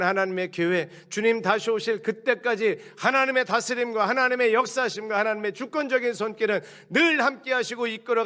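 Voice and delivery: high, loud preaching at full force; fervent preaching. Acted. Pastor rousing the congregation about God's sovereignty, impassioned and loud. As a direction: build the sermon to a ringing climax.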